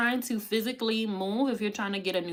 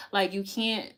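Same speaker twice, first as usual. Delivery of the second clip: normal talking voice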